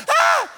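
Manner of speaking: a sudden, very high, loud shriek; startled scream. Retold or imitated. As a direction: shriek like someone caught off guard.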